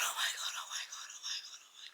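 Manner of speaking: fading to an unvoiced whisper; breathless whisper. Real, not acted. Reader overcome by the book, whispering oh my God. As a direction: whisper it like you can barely breathe.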